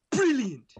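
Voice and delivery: exaggerated British "Brilliant"; British accent. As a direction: belt out a posh English "Brilliant". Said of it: "in an English accent".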